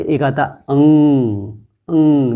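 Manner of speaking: अंग said slowly, humming through the nose; अंग with the sound resonating in the nose; nasal demo. Shown as a demonstration. Teacher demonstrates to pupils, nasal अंग. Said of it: "ऐसा लग रहा है नाक से आ रही है".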